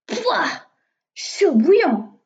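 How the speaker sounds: high yuck, then drawn-out loud cry; exclaimed disgust. A narrator's reading. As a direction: exclaim at a burning hot mouthful. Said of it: "s'excria-t-elle".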